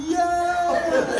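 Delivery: long, high drawn-out "yeah"; imitated crowd's rising approval; crowd cheer. Crowd cheers her on, acted out.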